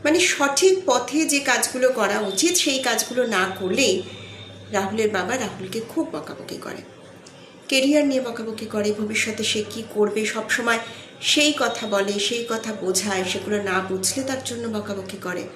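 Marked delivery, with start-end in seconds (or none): none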